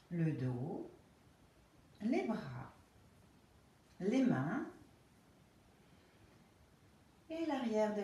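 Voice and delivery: slow, calm Yoga Nidra guidance with long pauses; guided relaxation. Real, not acted. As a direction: ease them into rest, phrase by unhurried phrase.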